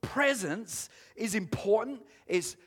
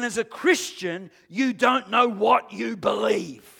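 preaching at full force; 5 semitones higher, 6 dB louder than usual. Real, not acted. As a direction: drive the truth home to them.

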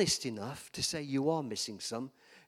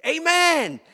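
loud amen; 14 semitones higher, 13 dB louder than usual. Retold or imitated. Preacher calling out amen in a Baptist church, loud and high. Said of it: "and I went".

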